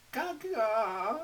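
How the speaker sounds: put-on high, loud cawing baby cry; baby raven cry. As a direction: squawk it like a demanding baby bird. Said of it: "He began to cry".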